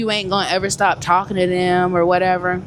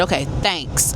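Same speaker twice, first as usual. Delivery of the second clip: forced thanks; 6 semitones lower, 4 dB quieter than usual. Retold or imitated. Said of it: "the hardest thanks of my life".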